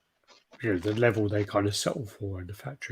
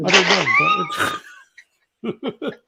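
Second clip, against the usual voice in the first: mock bafflement; 5 dB louder, much slower than usual.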